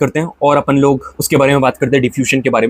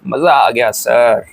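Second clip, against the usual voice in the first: lower, slow mock student sigh of delight; 6 semitones lower, much slower than usual. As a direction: play a pleased student, drawn out and lower. Teacher voices delighted student. (छात्र की नकल, धीमा और नीचा)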